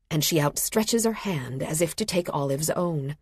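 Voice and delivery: plain reading voice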